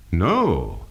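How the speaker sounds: "No" with a big definite fall; firm denial. Shown as a demonstration. Teacher refusing a legacy example, firm falling "No". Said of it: "a more definite fall on a firm denial".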